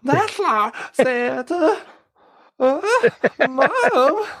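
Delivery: put-on high, slow childish whine; whiny little boy voice. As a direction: mimic a whiny cartoon kid. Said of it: "the way he talks".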